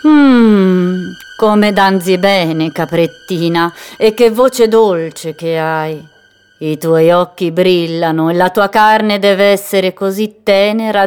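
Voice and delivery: slow, nasal, hungry purr. Acted. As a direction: savour each word like a predator. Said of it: "una voce nasale".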